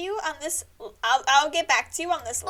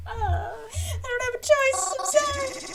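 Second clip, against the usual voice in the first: much higher, whiny plea; squeaky apology; 9 semitones higher than usual. Acted. Mary apologising to Groven, squeaky whine. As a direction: whimper the apology.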